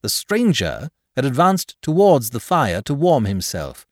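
ordinary delivery, nothing marked

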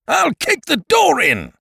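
high, slow furious bellow; angry shout. A narrator's reading. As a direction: bellow the threat. Angry creditor threatens.

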